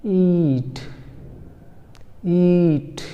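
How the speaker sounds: teacher demo of ईट; long ee. Shown as a demonstration. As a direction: draw out the ee in ईट. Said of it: "ऐसा लिखेंगे".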